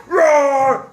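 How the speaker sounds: loud playful tiger roar. Pretend. Tiger roaring at Pharaoh, loud and playful. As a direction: roar like a big cat.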